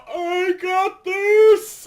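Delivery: high, loud, slow battle cry; confident yell. Retold or imitated. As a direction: shout it like you're charging in. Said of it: "I hear my friend Juan go".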